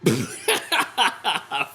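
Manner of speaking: real, helpless cackle; sudden long laugh; burst of laughter. Real, not acted. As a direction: crack up laughing. Laughing at the segment.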